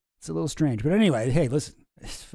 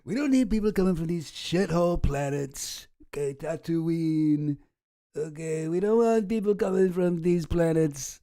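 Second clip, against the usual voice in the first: Trump impression. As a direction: do your best Trump. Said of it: "a Trump impression like me".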